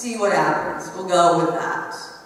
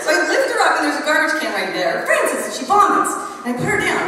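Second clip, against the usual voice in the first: higher, louder narration through laughter; laughing; 4 semitones higher, 4 dB louder, faster than usual. Real, not acted. Teacher laughing at her own classroom story. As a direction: tell it while laughing hard.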